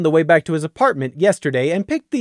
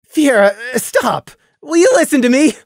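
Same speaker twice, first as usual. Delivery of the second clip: higher, louder exasperated plea; frustrated, cut him off; 7 semitones higher, 5 dB louder than usual. Acted. Randy frustrated.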